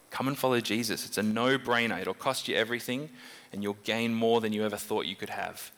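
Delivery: usual speaking voice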